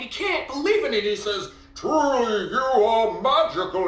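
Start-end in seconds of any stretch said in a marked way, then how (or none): 1.7-3.9 high, put-on voice of an amazed king; excited king voice. A narrator's reading. King so excited he can't believe it, character voice. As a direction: play the king overcome with excitement, pitched way up. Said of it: "That's his voice".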